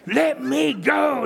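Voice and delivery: high, loud, slow possessed shriek; demonic wife. Retold or imitated. Husband imitating his furious wife as a possessed beast, high and loud. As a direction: let the demon scream through her. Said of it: "like a demonic animal was trying".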